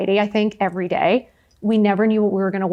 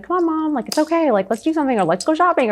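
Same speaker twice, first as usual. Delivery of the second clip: upbeat coaxing; 7 semitones higher than usual. Retold or imitated. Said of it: "I was the only person that would be like".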